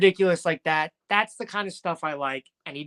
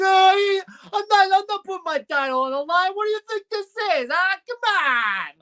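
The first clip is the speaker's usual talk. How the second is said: loud, high-pitched mafioso Italian caricature; mock Italian mafioso; 13 semitones higher, 5 dB louder than usual. Wrestling fan mocks Logan's inflection, exaggerated mafioso Italian accent. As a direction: whine and holler it like a cartoon Italian mobster. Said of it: "real, like, trying to sound mafiosa".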